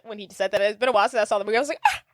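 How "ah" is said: sharp, high mock gasp; re-enacted gasp. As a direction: gasp as if shocked by the proposal. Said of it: "I think I actually gasped".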